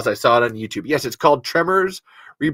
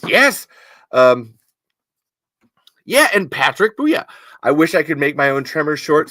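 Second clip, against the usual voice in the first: ordinary talk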